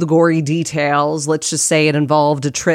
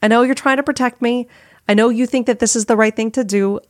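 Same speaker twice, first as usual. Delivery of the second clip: higher-pitched, quick acknowledgment; placating reply; 6 semitones higher, faster than usual. Retold or imitated. Podcaster answers her inner critic, higher and placating. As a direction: humour the nagging voice. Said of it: "I hear her and I'm like".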